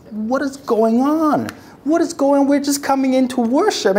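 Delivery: bewildered. Acted. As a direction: sound baffled and caught off guard. Worshippers bewildered.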